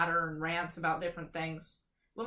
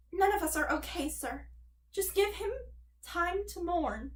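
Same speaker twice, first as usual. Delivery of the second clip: pleading mother voice; 9 semitones higher than usual. Acted. Mother's quote in story.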